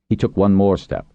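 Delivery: ordinary delivery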